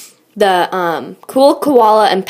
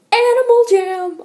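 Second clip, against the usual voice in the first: much higher, sing-song game title; game announcer; 11 semitones higher than usual. Pretend. Naming the game like an ad.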